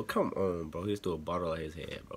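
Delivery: low, soft, let-down protest; subdued, disappointed pleading; quiet dismay. Real, not acted. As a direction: object softly, voice dropped low in disappointment. Dismayed by bottle thrown at man.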